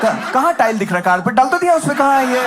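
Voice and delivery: hammed-up indignation; mock indignant uncle. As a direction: play an uncle loudly denying his bald patch. Old uncles acted denying baldness.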